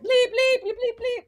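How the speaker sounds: high, loud playful bleeping; a high, loud mock censor beep; mock censor bleeps. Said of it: "we should bleep all my bleep".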